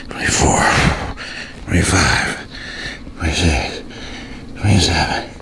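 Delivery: low, breathy counts squeezed out between reps; strained counting. Real, not acted. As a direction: count under strain, pushing out each number. Counting reps during plank raises.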